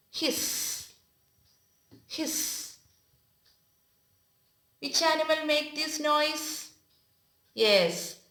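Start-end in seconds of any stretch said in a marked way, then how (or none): 0.1-2.8 soft unvoiced hissing; snake hiss. Shown as a demonstration. Teacher hissing softly like a snake for class. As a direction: whisper it like a snake.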